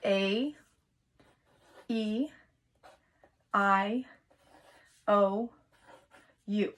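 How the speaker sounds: each vowel said slowly and apart; A, E, I, O, U を一つずつ区切って; vowels spelled out. Shown as a demonstration. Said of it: "write the five vowels".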